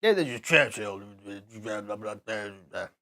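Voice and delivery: light mock Churchill mumble; a hint of Churchill growl; Churchill impression. Doing Churchill for the stream as a joke, lightly voiced. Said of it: "that's as close to Churchill as I".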